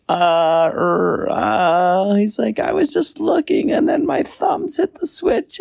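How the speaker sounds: high, stammering excuse in a small voice; nervous raccoon. Acted. Caught raccoon stammering to his inventor, high nervous voice.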